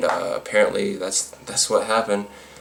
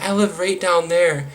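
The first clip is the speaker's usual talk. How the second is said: high, slow mimicking voice; mock protesting woman; 10 semitones higher, slower than usual. Drunk woman excusing herself to her accuser, mock high protest.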